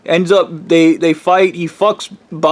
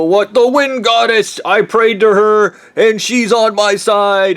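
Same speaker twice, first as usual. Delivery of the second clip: mad hermit; 6 semitones higher than usual. Retold or imitated. Crazy Bob explains his goddess.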